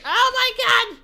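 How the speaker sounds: high panicked shrieking; screaming in sudden fright; terrified scream. Real, not acted. Creature suddenly comes alive.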